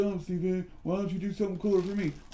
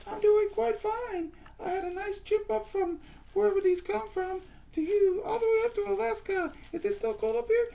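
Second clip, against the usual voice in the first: very high squeaky puppet voice; high-pitched puppet character; squeaky toy voice. Acted. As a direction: play a tiny squeaky doll. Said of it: "what kind of voice we have".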